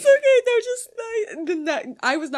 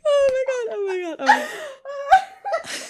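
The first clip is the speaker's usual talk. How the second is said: high, loud helpless laughter; laughing fit; 13 semitones higher, 5 dB louder than usual. Real, not acted. A reader cracking up at the fan fiction.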